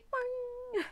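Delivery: high-pitched springy boing noise; comic "boing". Acted. Key bouncing back at the angel, high comic boing. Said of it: "And the key is like".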